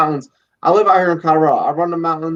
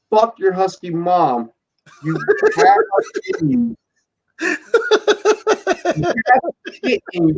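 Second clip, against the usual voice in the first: high-pitched mock rant at a lazy puppy; hammed-up scolding of a useless pup; mock yelling at the dog; 8 semitones higher than usual. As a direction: rant at the dog, half laughing. Berating dog, rising pitch.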